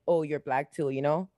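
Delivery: usual speaking voice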